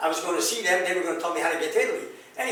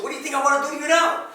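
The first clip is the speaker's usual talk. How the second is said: high, fast, heated comeback; retold angry retort; 8 semitones higher, 4 dB louder, faster than usual. Husband retelling confronting his wife, angry retort. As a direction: fire it back as in a retold quarrel.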